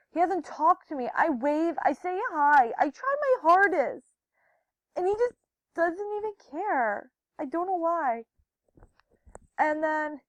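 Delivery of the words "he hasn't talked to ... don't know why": raised, pleading complaint; exasperated protest. Retold or imitated. Telling Hannah about being ignored by Jacob, exasperated. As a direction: protest in frustration, voice climbing high. Acts out own hurt protest.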